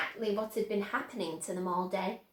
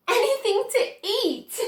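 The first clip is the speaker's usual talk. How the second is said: laughing; 9 semitones higher, 4 dB louder than usual. A narrator's reading.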